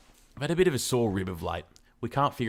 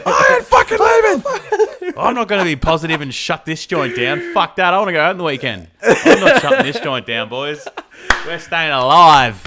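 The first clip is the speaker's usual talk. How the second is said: much louder and higher rowdy rant; mock defiant party chant; defiant rally shout; 8 semitones higher, 16 dB louder than usual. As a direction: yell it like a party rebel.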